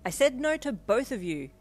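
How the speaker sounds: high, loud exasperated refusal. A narrator's reading. Refuses both quarrelling boyfriends.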